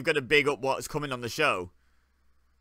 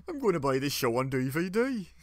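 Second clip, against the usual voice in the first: exaggerated sing-song Brummie attempt; mock Brummie, tune swooping up at the end; bad Brummie accent. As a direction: put on a poor Birmingham accent. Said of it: "I can't do a good Brummie accent".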